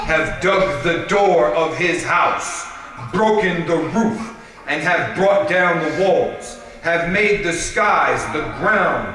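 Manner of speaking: solemn, emphatic supplication read aloud; declaimed litany, phrase by phrase; prayer recitation. Acted.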